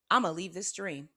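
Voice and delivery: ordinary talk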